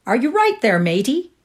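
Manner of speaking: loud workman's call. Acted. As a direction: call out across the garden. Workman calling out.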